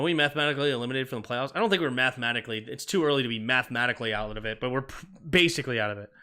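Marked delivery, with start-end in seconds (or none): none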